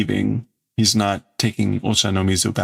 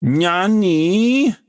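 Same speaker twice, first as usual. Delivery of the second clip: pouty Nani; 8 semitones higher, 3 dB louder than usual. Retold or imitated. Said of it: "it sounds kind of, um, pouty".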